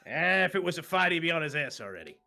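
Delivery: high, loud dismissive comeback; loud retort. Acted. Boone answering the scolding from behind, loud and cocky.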